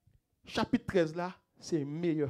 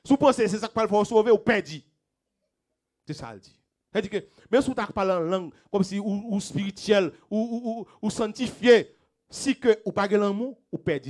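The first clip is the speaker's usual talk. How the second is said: louder, higher climactic sermon cry; full preaching; 6 semitones higher, 10 dB louder than usual. Acted. Pastor warning the congregation, preaching at full force. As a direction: thunder the warning at the church.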